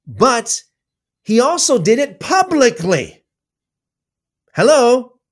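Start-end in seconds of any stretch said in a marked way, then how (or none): 4.5-5.2 loud and high, jolting listeners awake; a sharp, incredulous hello?; emphatic hello. Real, not acted. Preacher jolts his viewers to attention, loud and high. Rhetorical call to the audience.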